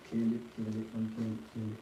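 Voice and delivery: hoarse, barely voiced rasp; strained raspy near-whisper. Real, not acted. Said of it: "I have no voice".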